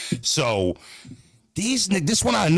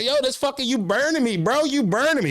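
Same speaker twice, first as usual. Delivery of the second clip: pained; 10 semitones higher than usual. Retold or imitated.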